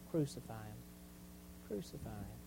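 very soft, low, grave delivery; hushed quotation. Acted. Preacher quoting the turned crowd days later, hushed. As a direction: drop almost to nothing on the crowd's cry.

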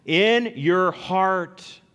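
"In your heart" stretched slowly, each word weighted; drawn-out emphasis. Shown as a demonstration. Preacher filling in the answer for the congregation, "In your heart" slow and weighted. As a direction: lean hard on "In your heart". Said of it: "shall be what".

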